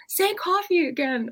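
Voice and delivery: high-pitched, stretched-out teasing impression; imitated fascinated woman. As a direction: mimic her delighted demand, slow and sky-high. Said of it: "And she's like".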